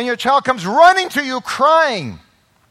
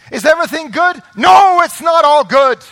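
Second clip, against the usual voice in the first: preaching shout; 7 semitones higher, 9 dB louder than usual. Real, not acted. Preacher's climax on lament.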